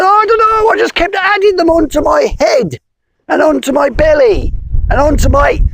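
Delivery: high squeaky plea; pleading spider. Acted. Spider begging the wizard, high and pleading. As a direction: beg in a tiny high voice.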